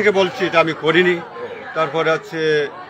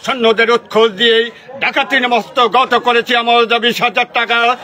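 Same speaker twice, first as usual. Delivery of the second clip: loud stage villain, building higher and higher; villain monologue; 5 semitones higher, 3 dB louder than usual. Shown as a demonstration. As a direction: declaim it like a jatra villain, building to a peak. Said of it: "তো আমরা এবার শুনবো আকর্ষণীয়, সংলাপ".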